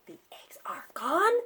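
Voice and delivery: hushed secretive whisper. Acted. Piggy hushing Gerald, secretive whisper. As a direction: breathe it like a secret.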